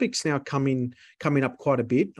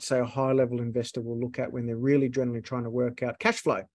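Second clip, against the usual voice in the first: ordinary delivery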